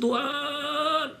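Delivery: retold crying plea to God, high-pitched; tearful prayer. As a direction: plead upward as if close to tears. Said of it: "saya nangis di situ".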